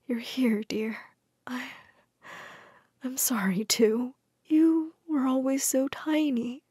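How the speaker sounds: soft, slow, frail whisper; weak whisper. A narrator's reading. Weak widow in hospital to Jane, whispering. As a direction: barely breathe the words. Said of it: "weak smile".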